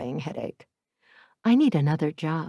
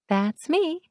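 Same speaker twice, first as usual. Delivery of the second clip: bright, much higher happy reply; happily; 10 semitones higher than usual. Acted. Shop owner answers Matteo, happily. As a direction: pop up cheerfully. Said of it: "I say happily".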